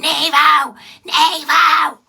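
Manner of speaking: loud, high-pitched yell of a name; imitated shouting. Imitating a stranger shouting at them in the street, loud mock holler. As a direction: yell it out as if hailing across a street. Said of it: "And then you start shouting".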